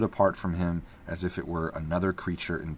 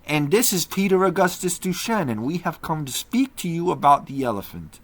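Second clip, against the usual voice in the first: higher, louder policeman's voice; Leo, earnest and steady; 10 semitones higher, 6 dB louder than usual. A narrator's reading. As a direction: speak up politely to the prisoner. Leo speaks to magician.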